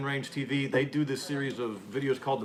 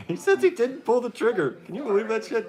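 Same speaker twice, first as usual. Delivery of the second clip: high, loud, laughing disbelief; incredulous laugh; 10 semitones higher, 7 dB louder than usual. Real, not acted. Host scoffing at Baldwin's excuse, incredulous laughing outburst. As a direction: burst out laughing in disbelief, much higher and louder.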